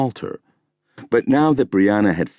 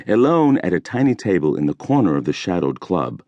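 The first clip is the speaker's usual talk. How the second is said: ordinary delivery, nothing marked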